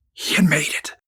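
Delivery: whispered in quiet disbelief; a soft awed whisper of good news; hushed whisper. A narrator's reading. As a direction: breathe it out, overcome. Reading the joyful acceptance letter.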